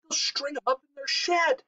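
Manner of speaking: high, tense little chipmunk; high-pitched anxious hiss. A narrator's reading. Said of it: "hissed Huggledug".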